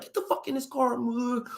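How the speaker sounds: very high, exasperated father's order; imitated irate dad. Imitating dad ordering truant son into the car, high and irate.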